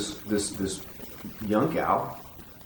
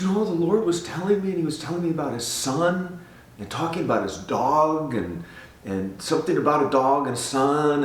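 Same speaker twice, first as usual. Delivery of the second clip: higher, louder, insistent retelling; imitated emotive woman; 7 semitones higher, 4 dB louder than usual. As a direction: act out her earnest emotional explanation. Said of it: "she's really emotive".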